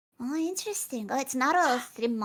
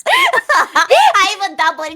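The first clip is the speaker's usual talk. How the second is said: loud, slow laughing words; 6 semitones higher, 9 dB louder, much slower than usual. Real, not acted. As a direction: laugh through the confession. Laughing while confessing.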